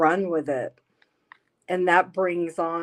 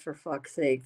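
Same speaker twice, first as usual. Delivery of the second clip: usual speaking voice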